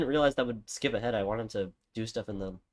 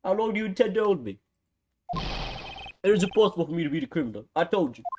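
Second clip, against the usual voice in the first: badly faked Scottish accent, pitched higher; horrible Scottish accent; 7 semitones higher, 4 dB louder than usual. Suspect protests being held, in a horrible Scottish accent. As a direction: put on a hammy, indignant Scottish accent. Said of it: "doing, like, a horrible Scottish accent".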